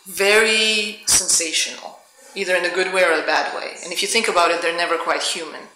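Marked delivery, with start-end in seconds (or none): none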